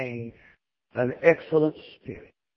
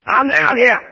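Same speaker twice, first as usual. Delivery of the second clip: cheerful Daniel; 6 semitones higher, 4 dB louder than usual. Retold or imitated. Daniel answers king cheerfully.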